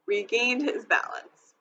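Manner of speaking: ordinary delivery, nothing marked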